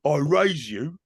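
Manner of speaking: joking hard-man impression; lightly put-on Cockney tough guy; Ray Winston. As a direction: play the betting hard man. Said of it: "not Ray Winston".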